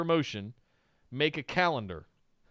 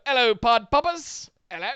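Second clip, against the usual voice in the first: hammed-up Irish greeting, higher; a deliberately terrible Irish lilt; jokey stage-Irish hello to listeners; bad Irish accent; 6 semitones higher than usual. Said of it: "I did an absolutely horrible Irish accent".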